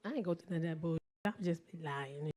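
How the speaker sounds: low, soft offhand admission; low confession. Acted. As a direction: own up to it quietly, deadpan. Same words as an aside admission.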